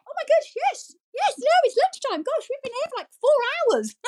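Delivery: high, startled, caught out; flustered surprise. Retold or imitated. Nursery worker caught out by lunch arriving, high and startled. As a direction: realise with a jolt how late it is.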